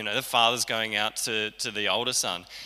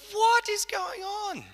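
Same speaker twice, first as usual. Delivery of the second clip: much higher, slow, puzzled; bewildered older son; slower than usual. Retold or imitated. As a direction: ask it like a baffled son hearing noise. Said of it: "He's like".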